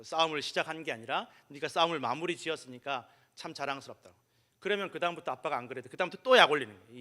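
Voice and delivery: ordinary talk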